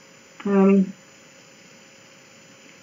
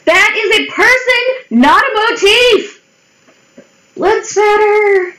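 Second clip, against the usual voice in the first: high, squeaky outburst of protest; mock indignation; 11 semitones higher than usual. Indignant at search results.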